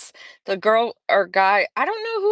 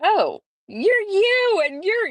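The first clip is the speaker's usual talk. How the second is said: starstruck; 13 semitones higher, slower than usual. Retold or imitated. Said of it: "I was just like".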